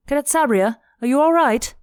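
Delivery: high, loud call of concern; alarmed concern. A narrator's reading. Zandy running after the cadet, high alarmed concern. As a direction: call after them, urgently checking.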